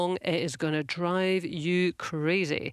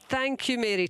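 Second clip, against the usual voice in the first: normal talking voice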